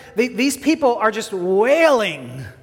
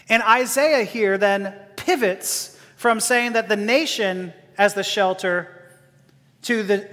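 ordinary delivery

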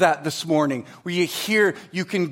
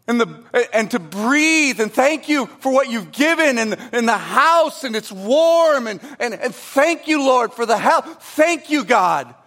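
higher, louder, lingering grateful prayer; heartfelt thanks; 6 semitones higher, 3 dB louder than usual. Shown as a demonstration. Pastor modelling sincere daily thanks to God, heartfelt. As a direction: savour each thank you as a real gift. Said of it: "But that's a really incredible thing".

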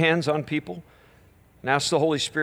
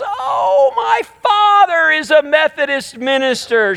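high, loud mock old lady exclaiming; mock shocked old lady, pitched up and loud; swearing old lady; 11 semitones higher, 5 dB louder, slower than usual. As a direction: play up her proud outburst, loud and high. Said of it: "she looks at me and she goes".

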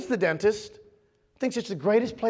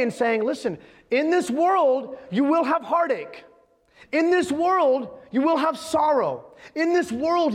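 emphatic, higher-pitched sermon delivery; preaching; 5 semitones higher, slower than usual. Real, not acted. Pastor relaying Jesus's warning to his disciples, urgent preaching. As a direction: drive each phrase home to the congregation.

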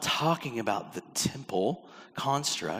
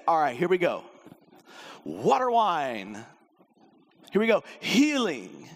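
mock casual Jesus; 3 semitones higher, 3 dB louder than usual. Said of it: "He didn't say".

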